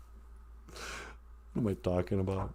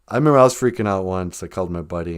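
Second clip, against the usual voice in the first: ordinary talk, nothing marked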